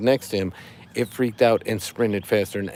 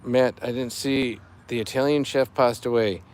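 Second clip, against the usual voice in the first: ordinary talk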